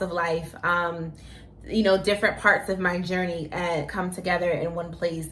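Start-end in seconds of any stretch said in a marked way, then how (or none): none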